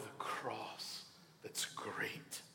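whispered, hushed ending; a pastor whispering a closing thought. Acted. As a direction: drop to a whisper for the cross. Hushed close of sermon point.

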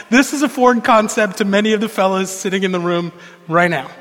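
usual speaking voice

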